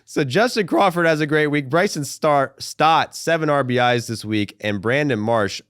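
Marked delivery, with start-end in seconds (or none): none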